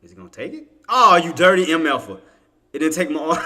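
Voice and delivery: usual speaking voice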